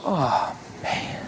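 breathy, hushed "oh, man" of dismay; whispered groan over a dented car; deflated sigh. Retold or imitated. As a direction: let the air out, disappointed. Disappointed sigh at wrecked car.

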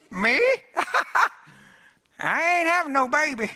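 high-pitched scoffing disbelief with a laugh; sarcastic, cynical laugh. Acted. Sarah scoffing at news she will conceive, cynical high-pitched laughing. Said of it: "cynically laugh, sarcastically".

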